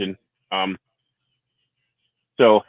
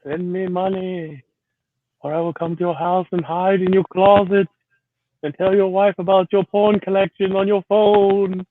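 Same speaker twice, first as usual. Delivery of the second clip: put-on higher, slow, creepy English accent; a menacing English gentleman, drawn out, higher pitch; 10 semitones higher, slower than usual. English accent extorting a chat viewer for money. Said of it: "That's even creepier done in an English accent".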